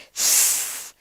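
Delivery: a soft unvoiced snake hiss. Shown as a demonstration. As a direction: breathe out a quiet hiss like a snake. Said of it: "What the sound made?".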